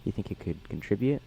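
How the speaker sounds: ordinary delivery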